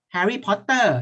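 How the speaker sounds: Thai-accented Harry Potter, "Potter" split as pot-PER; Thai pronunciation. Shown as a demonstration. Teacher mimicking how Thais say it, Thai accent. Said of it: "In Thai we say".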